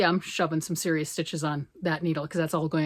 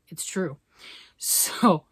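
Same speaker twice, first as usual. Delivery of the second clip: ordinary delivery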